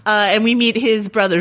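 ordinary delivery

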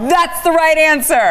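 laughing, high and bright through the words. Real, not acted. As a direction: giggle the answer out, delighted. Laughing reply to compliment.

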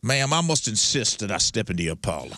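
put-on courtly Southern gentleman's accent; mannered, genteel Southern; high-born Southern accent. Gentleman asking a lady into the parlor, Southern accent. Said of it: "the high-born Southern accent".

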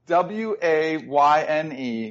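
slow letter by letter; spelling it out. Shown as a demonstration. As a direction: spell each letter slowly. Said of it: "I'm gonna say".

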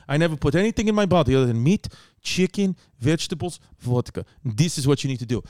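a low imitation of a Russian mover in a Russian accent; mock Russian mover. Mover giving advice.

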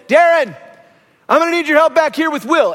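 loud call to the husband, firm. Retold or imitated. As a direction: summon him from the back room. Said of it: "she says".

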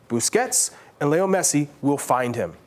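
usual speaking voice

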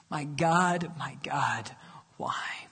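hushed whispered plea. Acted. Praying Jesus whispering his plea to God. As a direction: breathe the words in a whisper.

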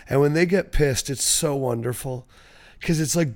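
ordinary talk

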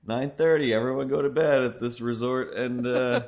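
a hammed-up son sending mom to bed; mock cool son. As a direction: play the hip podcaster bossing mom to bed, loud. Mocking the podcaster to his mom.

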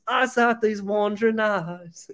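slow, high mock British croon; British rocker voice. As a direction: drawl it like a lovesick rocker. Russell Brand kind of guy.

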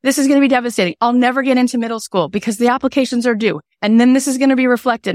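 mock devastated kid; imitating despairing daughter. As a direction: overdo the doom of a panicking child. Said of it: "daughter panicking".

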